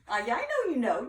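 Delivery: amused aside to listeners; laughing aside. Real, not acted. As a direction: laugh at your audience.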